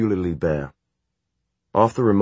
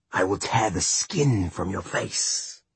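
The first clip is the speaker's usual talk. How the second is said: demon threat; 2 semitones higher, 3 dB quieter, slower than usual. Acted.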